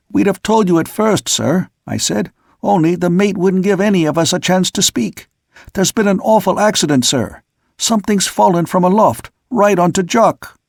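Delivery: high urgent report. A narrator's reading. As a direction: blurt it out urgently.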